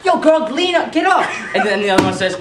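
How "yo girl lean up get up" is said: much higher, laughing mock call; hammed-up girl calling out; imitating a girl. Girl tells her friend to get up, high mock call. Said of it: "I hear".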